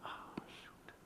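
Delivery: hushed, rueful whisper; barely voiced aside; whispered realization. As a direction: whisper it to yourself. Reacts to quiet conviction.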